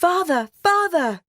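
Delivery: high, loud call to father; Beauty calling out to her father. Acted. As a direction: call eagerly down the lane. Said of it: "a small voice crying".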